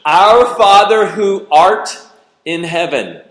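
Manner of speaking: loud, drawn-out pompous prayer voice; mock-formal prayer. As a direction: ham up the King James phrasing. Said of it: "How many of you talk like that?".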